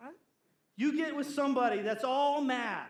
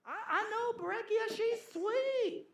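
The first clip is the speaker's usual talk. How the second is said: high, chirpy gossip voice, giggling; gossipy church lady; 13 semitones higher than usual. Retold or imitated. Preacher to congregation, mimicking a sweet town gossip, high and giggly. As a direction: coo the names like juicy news.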